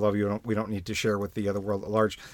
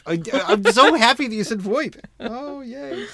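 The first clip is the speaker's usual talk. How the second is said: excited; 11 semitones higher, 3 dB louder, slower than usual. Real, not acted. Delighted at "voip.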